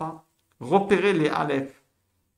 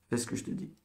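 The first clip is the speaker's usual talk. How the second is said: much softer, almost voiceless repeat; quiet, whispery command to a pupil; hushed insistence; 10 dB quieter, faster than usual. Real, not acted. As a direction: insist under your breath. Teacher repeats the order under breath.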